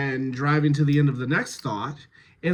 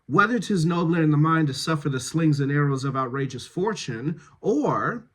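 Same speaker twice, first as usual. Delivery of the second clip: brisk verse with forward momentum; driving through the thought. Shown as a demonstration. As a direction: press on through each phrase to its end. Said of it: "driving to the end of your thought".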